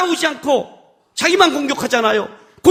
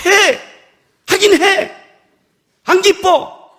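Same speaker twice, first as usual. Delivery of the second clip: higher, forceful grudging admission; 7 semitones higher, much slower than usual. Acted. Preacher admitting unwilling obedience to God, grudging and cross. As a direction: give in with open displeasure.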